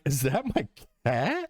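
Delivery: mock amazed rising question; incredulous question. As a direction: ask it like you can't believe it. Realising the cat talks.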